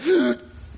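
a quick, high mock gasp of dismay. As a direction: gasp as if caught out. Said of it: "everybody's like".

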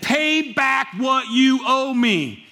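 menacing slow demand. Acted. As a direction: grind out each word like a creditor. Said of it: "he demanded".

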